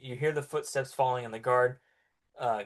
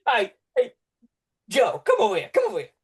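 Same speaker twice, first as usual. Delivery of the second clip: calling out; 8 semitones higher, 8 dB louder, faster than usual. Acted. Guard calls his friend over.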